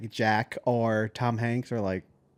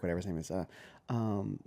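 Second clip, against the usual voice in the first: ordinary talk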